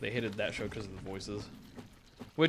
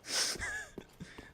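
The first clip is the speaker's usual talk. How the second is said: high squeal laugh; 7 dB quieter than usual. Real, not acted. Laughing at himself.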